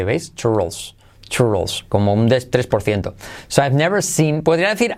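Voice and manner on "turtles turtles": "Turtles" with the L almost swallowed, low; barely sounded L. Shown as a demonstration. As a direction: say "Turtles" like "churros" with a trace of L. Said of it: "en tortugas casi ni se dice". ("Turtles" con la L casi muda)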